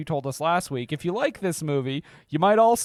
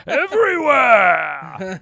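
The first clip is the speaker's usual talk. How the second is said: shouting; 7 semitones higher, 8 dB louder, much slower than usual. Acted. Joining the Pacino impression bit.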